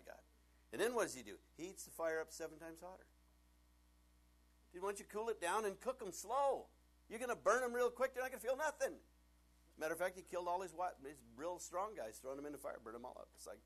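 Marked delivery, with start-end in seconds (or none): 4.7-9.0 high, loud mocking outburst; hammed-up sarcastic yell at the king; mock advice to king. Preacher mocking the king, raised voice.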